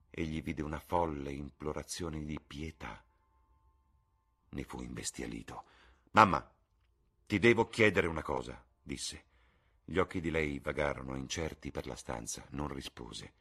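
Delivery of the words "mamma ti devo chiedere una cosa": loud, tense demand to his mother; urgent demand. Acted. Son confronting his mother, loud and tense. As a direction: son forcing out a hard question.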